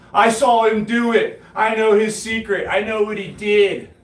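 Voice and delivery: high, loud mocking voice of the bragging rider; mocking bragger. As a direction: mimic the rider boasting in a high excited voice. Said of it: "he started bragging on the fitness app".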